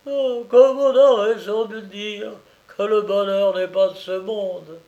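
a heartbroken lament; heartrending tone. Acted. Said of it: "d'un ton déchirant".

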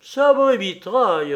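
loud, high defiant yell; bold shout. A narrator's reading. Pierrot boldly outshouting the king, defiant yell. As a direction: yell the oath back, outdoing him. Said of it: "en criant plus fort que le roi".

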